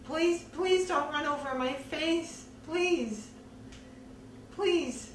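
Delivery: plead, re-enacting a scream without full volume; retold scream. Begging him not to run her over, restrained retold scream. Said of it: "And I screamed out".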